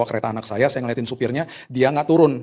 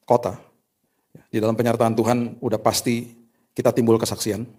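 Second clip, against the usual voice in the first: normal talking voice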